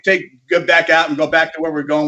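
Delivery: ordinary delivery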